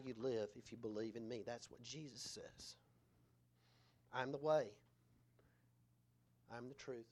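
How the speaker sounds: low, soft, solemn recital; hushed preaching. Acted. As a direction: let each saying settle between pauses.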